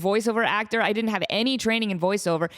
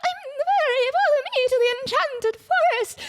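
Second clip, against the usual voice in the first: squeaky, very high fairy. Shown as a demonstration. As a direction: pitch way up, tiny and shrill. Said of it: "this is what I would do now".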